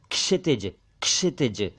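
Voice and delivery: क्षितिज begun with k plus sh, "kshitij"; correct ksh onset. Shown as a demonstration. Teacher correcting learners: क्षितिज as "kshitij". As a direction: say क्षितिज with a clear k before sh. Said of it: "जबकि यह है".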